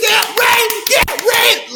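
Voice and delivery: very high squealed chant; hyped-up outburst. Retold or imitated. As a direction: squeal it way up high, twice over. High excited outburst about victory.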